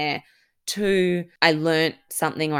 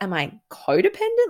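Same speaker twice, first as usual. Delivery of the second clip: startled question; 10 semitones higher, 4 dB louder than usual. Retold or imitated. Sudden realisation alone in Kenya.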